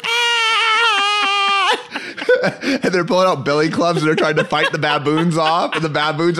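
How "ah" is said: high laughing shriek; laughing scream. Retold or imitated. Recalling the baboon raid, a high laughing shriek. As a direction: wail and crack up.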